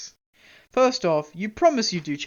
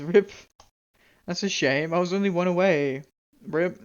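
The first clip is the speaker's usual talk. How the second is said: ordinary delivery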